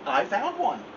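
a tiny polite chirp; little sparrow. Acted. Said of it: "said the sparrow".

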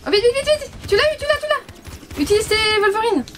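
high, excited hurry-up cries; excited urging. Real, not acted. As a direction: urge them on breathlessly. Urging teammate during chase.

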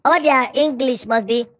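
ordinary delivery

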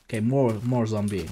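normal talking voice

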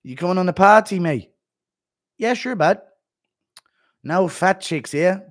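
a hint of a cheery Connor; Connor impression. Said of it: "impression of Connor".

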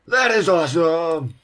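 loud thrilled yell; excited shout. Real, not acted. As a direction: shout it in delight. Trick shot landed.

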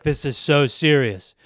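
deadpan fashion gravity, slow; mock serious perfume ad. Mocking fashion people's perfume ad, low slow solemn voice. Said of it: "people are just like".

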